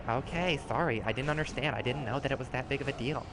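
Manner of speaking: soft, low, timid sorry; hushed, cowed apology; meek apology. Retold or imitated. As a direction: back down in a small quiet voice. Voices apologetic trial-account player.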